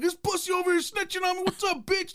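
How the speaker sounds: high-pitched comic confrontation; acted combative challenge. Inmate squaring up to a snitch, acted combative challenge. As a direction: pick a fight in a hammed-up high voice.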